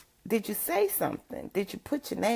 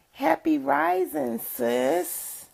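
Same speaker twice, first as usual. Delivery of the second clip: cheerful greeting; 8 semitones higher, much slower than usual. Real, not acted. Greeting a viewer joining live.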